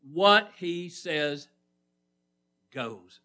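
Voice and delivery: very slow, drawn-out words; weighty preacher's lead-in; deliberate prompt. Real, not acted. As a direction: stretch each word and leave it hanging. Prompts congregation to finish phrase.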